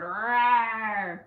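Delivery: playful drawn-out leopard growl; leopard snarl. Shown as a demonstration. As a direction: imitate a snarling leopard for children. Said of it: "Snarling like a leopard?".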